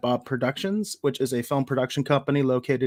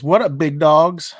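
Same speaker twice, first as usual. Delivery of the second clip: hype greeting; 3 semitones higher, 10 dB louder, slower than usual. Retold or imitated. Reading a chat greeting.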